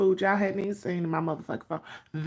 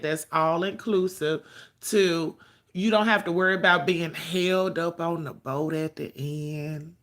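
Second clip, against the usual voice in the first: usual speaking voice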